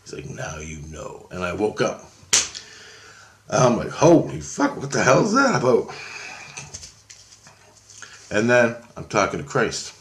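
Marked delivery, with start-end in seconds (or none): 0.3-1.3 low, quiet imitation of a menacing voice; understated deep-voiced threat. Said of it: "in a real deep voice". 3.9-5.9 high-pitched retold shock on waking; higher, baffled outburst; startled disbelief. Reacting on waking from the dream.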